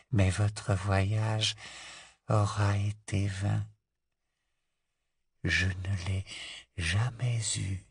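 put-on slow, soft, rasping old prisoner; frail old man. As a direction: speak slowly like a dying old man. Old prisoner answers Voldemort.

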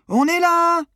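a long, very high, loud call; shouted hail. A narrator's reading. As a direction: call out across the dark. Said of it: "Maddy continua pourtant de crier". (appel crié, très aigu et fort)

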